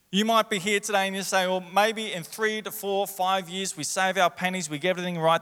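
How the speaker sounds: impassioned preaching. Real, not acted. Preacher voices the congregation's plans for a house, impassioned preaching.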